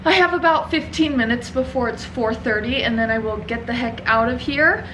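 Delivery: usual speaking voice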